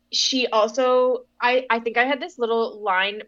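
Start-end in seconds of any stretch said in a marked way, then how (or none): none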